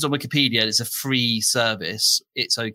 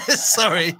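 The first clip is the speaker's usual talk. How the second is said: laughing through the word; giggly sorry; 6 semitones higher than usual. Real, not acted. Laughing apology.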